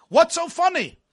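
acted teacher's challenge, high and loud; indignant teacher demand. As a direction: demand an explanation from a laughing pupil. Teacher repeats his demand.

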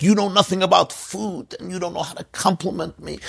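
high-pitched mock offence; hammed-up bickering, pitched high; indignant wife. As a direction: argue back as an affronted wife. Wife fights back in staged quarrel.

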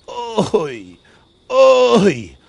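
oi rising into loud sobs; demonstrated sobbing. Shown as a demonstration. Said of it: "Is it a groan? Is it a sob?".

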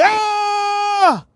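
a sudden high shout at a burglar; startled scream. Retold or imitated. A man hears a thief in his house and screams. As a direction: yell out sharply to scare off the thief. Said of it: "start screaming".

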